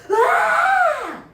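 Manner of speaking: high, piercing full scream; terrified scream. Acted. Fortunato chained behind the wall, screaming. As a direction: scream out loud in sudden terror. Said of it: "Fortunato starts screaming".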